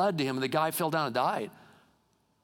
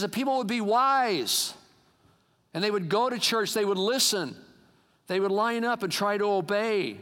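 higher-pitched, emphatic appeal; forceful preaching exhortation; 5 semitones higher, 3 dB louder than usual. Real, not acted. God wishing people would listen and obey, preached emphatically. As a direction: press the congregation to be wise.

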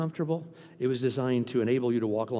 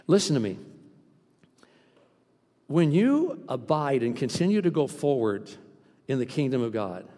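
ordinary talk, nothing marked

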